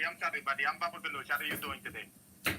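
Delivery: put-on jokey Indian-accented greeting. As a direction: play a friendly Indian character. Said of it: "Oh, he's doing an Indian".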